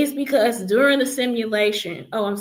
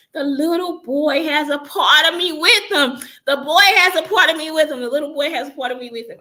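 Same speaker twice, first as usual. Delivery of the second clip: loud desperate shouting, pitched up; retold shout; 7 semitones higher, 6 dB louder than usual. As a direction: cry it out loud to the people taking him. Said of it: "I'm standing there crying and shouting".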